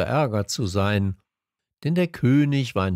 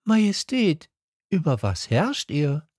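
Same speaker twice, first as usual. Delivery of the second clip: put-on high child voice asking; a boy puzzled by a tiny kingdom; little prince questioning the king; curious little prince; 7 semitones higher than usual. A narrator's reading. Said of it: "warf der König schnell ein".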